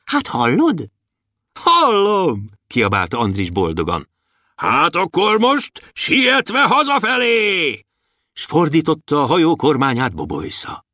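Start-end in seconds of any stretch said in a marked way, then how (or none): 0.0-2.4 voiced dwarf and boy. A narrator's reading. Dwarf and Andris exchange. 4.6-7.8 high, slow drawn-out shout homeward; rally the crew to sail home; jolly high dwarf shout; cheery call. A narrator's reading.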